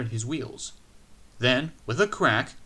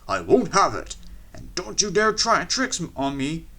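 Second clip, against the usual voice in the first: firm warning; 6 semitones higher, 5 dB louder than usual. A narrator's reading.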